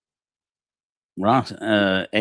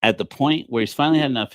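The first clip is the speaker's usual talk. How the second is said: ordinary talk, nothing marked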